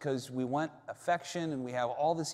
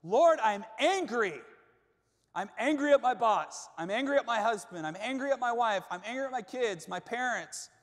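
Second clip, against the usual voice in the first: angry prayer; 8 semitones higher than usual. Pretend. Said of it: "we come into our prayer closet".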